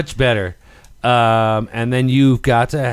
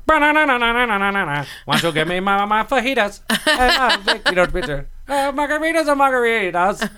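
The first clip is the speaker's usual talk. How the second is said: put-on Mexican accent; 8 semitones higher than usual. Answering door in sombrero.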